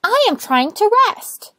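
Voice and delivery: high, loud and cross; annoyed little rosebud. Acted. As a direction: complain about the racket, high. Rosebud irritated.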